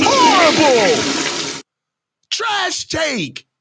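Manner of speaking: slow, stretched out Horrible in disgust; drawn-out disgust. Real, not acted. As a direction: drag the word out, fed up. Reacting to bad take.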